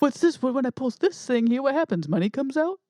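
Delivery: Mark Wahlberg impression. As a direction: puzzle over a gadget like a confused lunk. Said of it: "He'd be all like".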